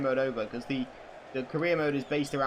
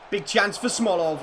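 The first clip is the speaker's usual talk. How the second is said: louder, higher quick chance call; excited call; 5 semitones higher, 7 dB louder than usual. Real, not acted. Commentating chance.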